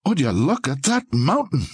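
put-on Scottish accent, breathless with wonder; awed Scottish lilt, short of breath; breathless Scottish burr. A narrator's reading. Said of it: "in a breathless Scottish burr".

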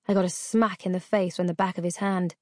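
ordinary delivery, nothing marked

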